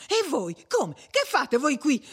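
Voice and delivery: high, startled string of questions; astonished questioning. A narrator's reading. Perpetua recognises the betrothed couple at the door, astonished questioning. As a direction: demand an answer in astonishment.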